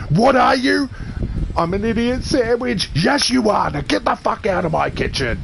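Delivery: hammed-up furious TV chef berating someone; Gordon Ramsay impression. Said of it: "switched over to Gordon Ramsay".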